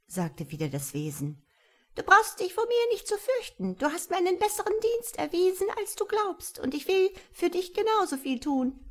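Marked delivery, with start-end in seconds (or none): 1.9-8.8 high, chirping little creature; friendly creature. A narrator's reading. Tiny creature reassuring frightened Tom, high and chirpy. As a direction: reassure him in a tiny birdlike voice.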